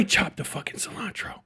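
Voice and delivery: breathy secret boast; whisper. Real, not acted. Ex-Chipotle worker confessing he chops the cilantro, whispered. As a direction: let it slip out as a whisper. Bragging about prep work.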